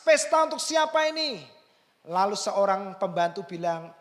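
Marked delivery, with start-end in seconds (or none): 0.1-1.6 high, loud indignant question; elder son's high, loud angry demand. Retold or imitated. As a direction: demand it like an offended son. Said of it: "Lalu dia marah".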